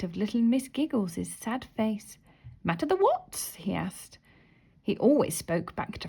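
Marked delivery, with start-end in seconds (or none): 2.6-3.6 cheery question. A narrator's reading. Topsy-Turvy asks, back to front.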